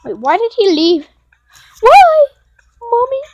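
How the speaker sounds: loud, high-pitched wailing cry; dismayed wail. Real, not acted. Gamer protesting that a player left, high loud wail. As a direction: cry out, climbing to a shriek.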